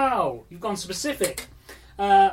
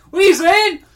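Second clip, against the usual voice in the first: shouting; 11 semitones higher, 13 dB louder than usual. Acted. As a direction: bellow the directions at the driver. Shouting directions at the driver.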